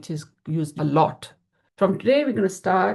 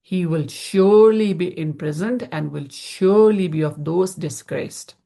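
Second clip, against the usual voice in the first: SURELY stressed loud and drawn out, both times; emphatic translation; 4 dB louder than usual. Retold or imitated. Teacher translating the master's wife's threat, emphatic on "surely". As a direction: punch each "surely" as a certainty. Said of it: "so he, she threatened him".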